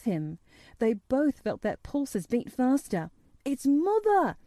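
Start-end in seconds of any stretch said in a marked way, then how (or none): 3.4-4.4 higher, gladder repeat of the cry; excited call. A narrator's reading. Dickon greeting his mother halfway across the grass, excitedly. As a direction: call it out again, brighter. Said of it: "Dickon said again".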